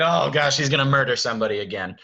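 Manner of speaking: loud, rushed dread; alarmed castle staff. Acted. Staff fearing Beast will kill somebody, alarmed. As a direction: blurt it out in mock alarm. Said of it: "this is like".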